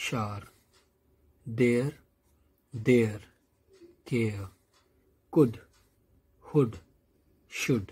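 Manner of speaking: each word said slowly and clearly, one by one; reading aloud for class. Shown as a demonstration. Teacher reading a word list aloud to students, clear and deliberate. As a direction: model each word so children can repeat. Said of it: "say aloud, जोर से बोलेंगे".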